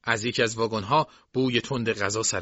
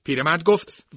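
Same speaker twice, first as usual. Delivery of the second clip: ordinary delivery, nothing marked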